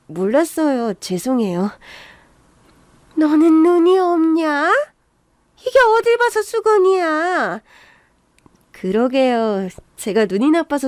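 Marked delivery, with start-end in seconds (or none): 3.2-7.6 high, slow, stretched-out scolding; indignant scolding. Acted. In-law scolding a woman who mistook a rag, indignant. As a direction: drag out each question in exasperation.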